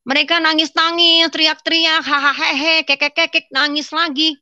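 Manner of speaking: high, loud sing-song mockery; mocking mimicry. Newcomer describes worshippers crying and shouting, mocking them. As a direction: rattle it off high, aping hysterical wailing and cackling.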